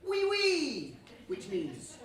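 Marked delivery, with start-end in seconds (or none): 0.1-1.0 "Oui, oui" called out high and loud; shouted reply. Shown as a demonstration. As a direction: show the crowd how to shout back. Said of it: "I want you to shout back".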